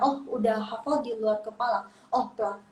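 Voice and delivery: usual speaking voice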